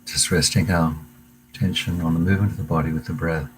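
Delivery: calm meditation guide voice, unhurried pauses; guided meditation. Acted. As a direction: lead the class gently into the breath. Leads a breathing meditation.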